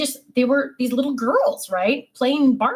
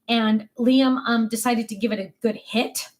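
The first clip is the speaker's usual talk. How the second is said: normal talking voice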